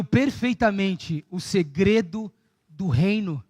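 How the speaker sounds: soft, low altar call; quiet altar call. Acted. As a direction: draw them in gently toward the altar.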